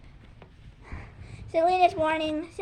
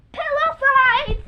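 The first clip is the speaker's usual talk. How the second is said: shouted pillow fight, slow and higher; loud call; 10 semitones higher, 11 dB louder, slower than usual. Shown as a demonstration. Kid yelling the trick name during the demo. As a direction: yell it as pillows fly. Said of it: "So it's like this".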